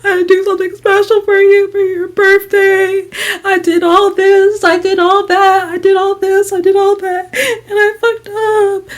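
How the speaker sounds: high, slow, sobbing mock apology; imitating crying. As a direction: wail it out like a sobbing roommate. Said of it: "And she's just like".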